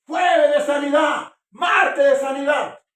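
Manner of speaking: mock healing-preacher shout, high and slow; imitando a un predicador sanador. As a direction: belt it out like a hammed-up revival announcer. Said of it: "hay iglesias que dicen". (grito burlón de predicador milagrero)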